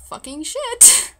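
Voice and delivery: slow, high-pitched exasperated swearing; retold dismay. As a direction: draw it out as a strained inner curse. Retold dismay at unwanted company.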